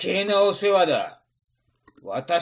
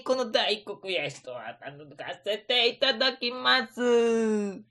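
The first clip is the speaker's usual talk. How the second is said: ordinary delivery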